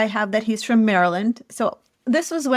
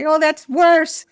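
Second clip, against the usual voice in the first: slow, higher-pitched mock complaint; imitating co-host's disapproval; 5 semitones higher, 3 dB louder, slower than usual. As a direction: draw out an imagined objection. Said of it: "you're gonna be like".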